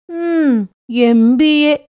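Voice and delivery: teasing, stretched out letters; drawn out. A narrator's reading. Sornambal teasing Lakshmi about her MBA, drawn out. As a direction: drag out the degree mockingly. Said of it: "என்று இழுத்து சொன்னாள்".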